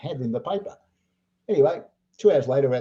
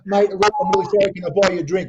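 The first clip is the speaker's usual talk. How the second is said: loud, higher, retold apology amid laughter; apologetic; 6 semitones higher, 7 dB louder than usual. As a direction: replay an emphatic apology to a stranger. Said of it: "I apologise to the guy".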